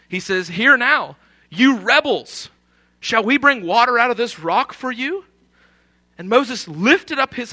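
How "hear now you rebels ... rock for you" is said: loud, high, commanding rebuke; acted anger. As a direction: call a crowd to heel as a provoked leader. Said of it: "He's angry".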